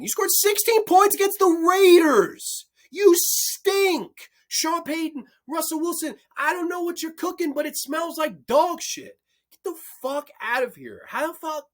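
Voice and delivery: high, loud outburst at the team; furious rant. Real, not acted. Host blasting Denver's coach and quarterback, furious shout. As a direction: lose it at the Broncos. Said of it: "I'm just too passionate".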